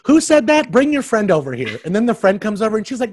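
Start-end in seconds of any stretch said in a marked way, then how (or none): 0.1-1.8 confrontational demand. Retold or imitated.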